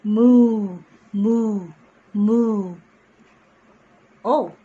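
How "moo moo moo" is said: big booming moo; loud cow moo. Shown as a demonstration. Teacher showing kids a cow, loud mooing.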